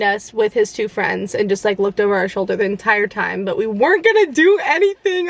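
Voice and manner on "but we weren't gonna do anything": high, exasperated protest. Real, not acted. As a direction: throw your hands up, high. Defending herself, exasperated.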